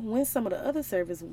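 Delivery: ordinary delivery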